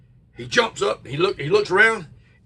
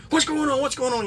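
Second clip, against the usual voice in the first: higher, anxious mother's voice; worried mom; 9 semitones higher than usual. Retold or imitated. Mother hurries over to the screaming boy, higher worried voice. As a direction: voice mom rushing in, alarmed.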